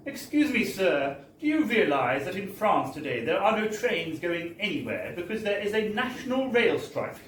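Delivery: high, sugary mock-polite French voice, laughing midway; sweetly cutting French clerk. Ticket clerk scolding the English narrator, sugary mock-polite. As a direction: lay on sickly-sweet politeness before the blow. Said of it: "With all the sweetness of a guillotine operator".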